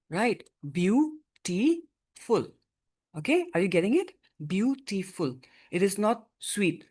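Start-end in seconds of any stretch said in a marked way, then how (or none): 0.7-2.5 "Beautiful" slowly split into three beats; teacher sounding out "Beautiful" with clear gaps; syllable by syllable. Shown as a demonstration. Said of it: "we pronounce this as beautiful". 4.5-5.4 "Beautiful" in three distinct beats; syllable by syllable. Shown as a demonstration. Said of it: "we pronounce this as beautiful".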